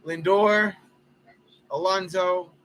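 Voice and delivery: lively baseball play-by-play; play-by-play call. Acted. Announcing his ballgame to friends, live broadcaster style. Commentates his video game.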